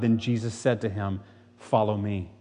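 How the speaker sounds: low, measured Bible reading; solemn gospel reading to the congregation; scripture reading. A narrator's reading. Reads scripture passage aloud.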